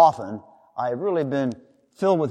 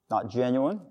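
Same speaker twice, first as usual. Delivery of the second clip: ordinary talk, nothing marked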